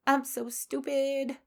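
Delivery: high-pitched sigh of self-reproach; self-berating. Acted. Host mimicking everyday negative self-talk, self-berating.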